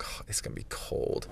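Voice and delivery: hushed, breathy little whisper; whispered worry. Retold or imitated. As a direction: whisper it like a nervous aside. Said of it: "and so I was like".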